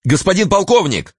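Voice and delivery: high flustered, glad call; flustered shout. A narrator's reading. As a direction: call out confused but relieved. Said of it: "сметенно и в то же время обрадованно закричал".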